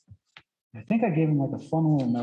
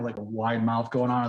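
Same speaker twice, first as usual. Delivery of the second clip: usual speaking voice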